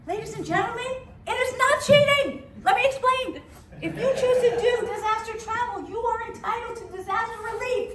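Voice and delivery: high, emphatic comic speech; mock proclamation. Comedian justifying herself to the audience, mock proclamation. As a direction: plead the case loudly to the whole room.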